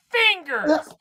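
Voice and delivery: high loud yell; shouting. Real, not acted. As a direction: shout the word out. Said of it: "you just start screaming over".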